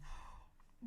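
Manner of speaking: soft unvoiced gasp of surprise; mock gasp. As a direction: gasp in pretend shock.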